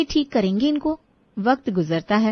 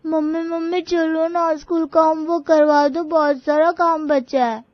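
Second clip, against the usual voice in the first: little daughter; 6 semitones higher than usual. Acted. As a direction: play the small girl tugging at mum. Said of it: "तभी वहां स्वरा की बेटी आती है।".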